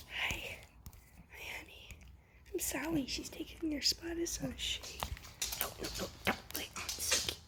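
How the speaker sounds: breathy hush throughout; whispering. Real, not acted. Owner whispering to dogs while Suki sleeps. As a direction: whisper so the dog won't wake.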